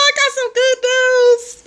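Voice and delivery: high, slow singsong tease; singsong announcement. Real, not acted. Host teasing her stream viewers with news, high singsong. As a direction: sing the good news out to tease it.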